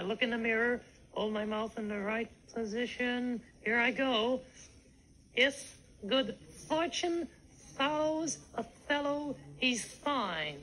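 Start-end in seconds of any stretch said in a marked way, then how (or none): 5.3-10.6 "fortune follows a fellow" haltingly, TH for each f; word-by-word practice, "fine" starting with TH; TH for every F. Shown as a demonstration. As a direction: labour through "If" and "fine" without moving the lips. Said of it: "E T H instead of F".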